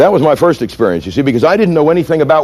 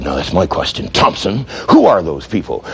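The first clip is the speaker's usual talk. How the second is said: low-voiced boss taking control; stern questioning; 7 semitones lower than usual. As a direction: imitate a chief's low, pointed demand. Chief confronts him, acted.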